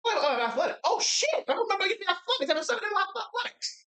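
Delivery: sudden high, excited squeal; real excited discovery. Real, not acted. Player spots a forgotten skill on the sheet, excited. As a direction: blurt it out high, thrilled at the find.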